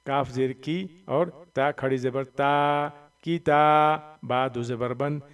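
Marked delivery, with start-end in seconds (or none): 3.2-4.2 "किता" with quick कि, held ता; long ता. Shown as a demonstration. Teacher showing which letter stretches, long ता in "किता". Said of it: "सिर्फ ता को खींचेंगे".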